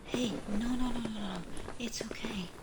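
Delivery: tender voiceless hush; gentle relieved whisper; soothing whisper. Acted. Relieved comfort after waking.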